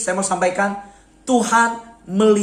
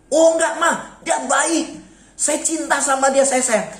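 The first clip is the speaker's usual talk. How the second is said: put-on higher, eager young son begging mother; pleading son; 6 semitones higher, slower than usual. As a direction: plead like a smitten young man.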